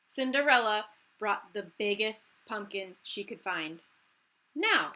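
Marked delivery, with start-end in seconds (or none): 4.5-4.9 brisk godmother. Acted. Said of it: "said the fairy godmother".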